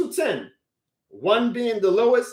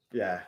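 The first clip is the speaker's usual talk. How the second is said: much lower and softer, sighed out; sighing yeah; 12 semitones lower, 7 dB quieter than usual. Real, not acted. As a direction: trail off into a defeated sigh. Fan trailing off in despair.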